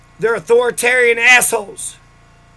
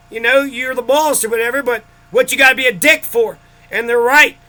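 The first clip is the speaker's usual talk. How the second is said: ordinary talk, nothing marked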